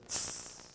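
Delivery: quiet hissed "tss"; imitated angry tss. Wife testing her husband, imitated cross hiss. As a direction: mimic her recoiling hiss. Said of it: "she just lets her reaction come up".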